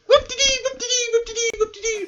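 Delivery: very high sing-song whoop; excited cheering. Acted. Delighted about getting a dog, high excited cheer. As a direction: squeal it in a high, bouncy chant.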